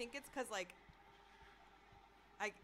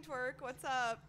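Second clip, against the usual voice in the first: ordinary talk, nothing marked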